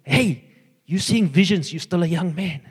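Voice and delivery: a cheeky divine aside, loud and lifted; the Lord teasing. Pretend. The Lord jokingly teasing the speaker about his age, loud and high. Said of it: "picture of the Lord going this to me".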